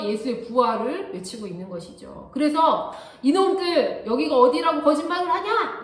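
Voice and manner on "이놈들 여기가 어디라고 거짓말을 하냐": raised, louder telling-off; mock angry rebuke, pitched up and loud; indignant scolding. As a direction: scold them like an outraged authority. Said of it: "이렇게 혼내고 싶은데".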